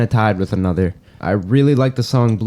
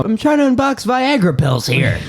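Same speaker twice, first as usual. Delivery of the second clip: silly voice; 12 semitones higher than usual. Pretend. Joking reply in silly voice.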